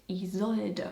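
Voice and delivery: Isolde in three syllables, "ee-ZOL-duh"; German pronunciation. Shown as a demonstration. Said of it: "And you say it".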